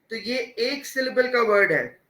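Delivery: normal talking voice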